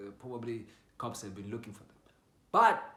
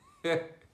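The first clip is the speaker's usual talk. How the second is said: short loud high laugh; 7 semitones higher, 10 dB louder than usual. Real, not acted. Storyteller laughing before revealing the twist, short loud laugh. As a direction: burst out in a brief laugh.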